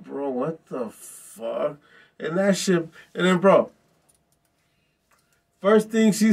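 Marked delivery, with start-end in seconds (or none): 0.0-1.8 stunned disbelief. Retold or imitated. Stunned reaction to the lady.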